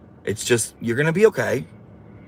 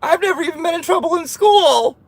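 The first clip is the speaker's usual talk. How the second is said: high, mocking whiny imitation. Streamer mocking a viewer's whiny comment in a whiny voice. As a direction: squeal it back as a whiny brat. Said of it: "you came across all whiny".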